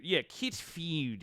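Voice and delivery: quiet, slow South African "kit feud"; a hint of the District 9 accent on kit feud; mild South African accent. Repeating the District 9 line softly in a South African accent. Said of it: "the guy in District nine saying".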